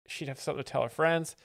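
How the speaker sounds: usual speaking voice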